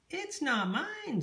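high, drawn-out little mouse squeak; squeaky mouse. A narrator's reading. Mouse answering Peep about the song, squeaky. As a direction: squeak it slowly like a tiny mouse. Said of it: "squeaked the mouse".

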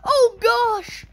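usual speaking voice

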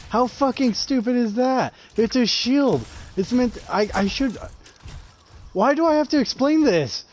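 vent, baffled and furious; real anger. Real, not acted. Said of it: "Why am I so angry right now".

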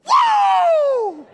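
huge high shout of amazement; triumphant whoa. Acted. David holding up the head to his buddies, huge shout. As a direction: yell it across the valley. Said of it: "looks at each other like".

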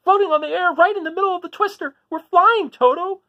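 high, loud amazed cry; Dorothy crying out. Acted. Dorothy to Toto in the twister, crying out in wonder. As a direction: call it out in breathless wonder. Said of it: "Dorothy cried".

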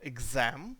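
exam with the stress on ZAM; stress off first syllable. Shown as a demonstration. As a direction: say exam leaning on the last syllable. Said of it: "а не на перший наголос".

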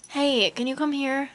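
normal talking voice

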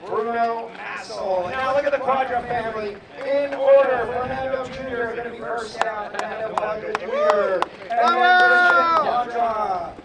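arena announcer introduction. Real, not acted. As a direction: roll out the family's names grandly. Introduces drivers to the crowd.

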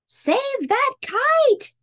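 high, slow, urgent call; urgent shout. Acted. Mole calling out as the kite plunges, high slow shout. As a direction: stretch out a panicked plea to save it. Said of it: "shouted Mole".

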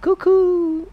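high falsetto cuckoo; cuckoo call. Pretend. Streamer voicing chat's bird impression, high cuckoo. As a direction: sing it like a cuckoo clock. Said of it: "I'll do your impression of what I read".

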